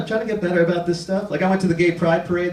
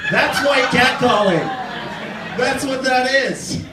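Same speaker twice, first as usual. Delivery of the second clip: slow punchline; 5 semitones higher, slower than usual. Acted.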